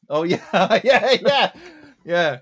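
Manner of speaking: normal talking voice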